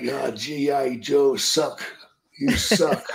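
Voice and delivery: imitated Japanese doctor, slow at first, in a Japanese accent; lightly mocking Japanese accent. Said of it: "And he's like".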